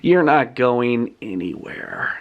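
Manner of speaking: low, slow villain threat; acted menace, deep and unhurried; menacing villain. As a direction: draw it out low like a supervillain. Villain threatens after evil laugh.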